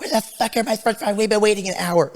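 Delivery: high-pitched imitation of an angry customer; hammed-up, high aggressive complaint; mad aggressive mom. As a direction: mimic an irate mom berating staff. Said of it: "the mom came over mad aggressive".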